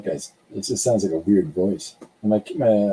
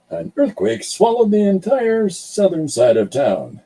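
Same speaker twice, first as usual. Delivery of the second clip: higher, hammed-up breaking news read; mock news anchor; 7 semitones higher than usual. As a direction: announce the disaster like a TV anchor, half joking. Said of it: "people are always expecting me to announce".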